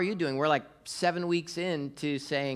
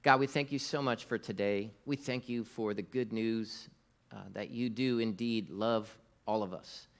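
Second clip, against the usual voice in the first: softer, hushed prayer voice; 3 semitones lower, 3 dB quieter than usual. Acted. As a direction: pray quietly and sincerely. Opens a prayer.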